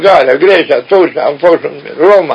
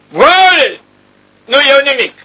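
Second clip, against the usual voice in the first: emperor's proud refusal; 10 semitones higher, slower than usual. Retold or imitated. Dying emperor refuses to take anything.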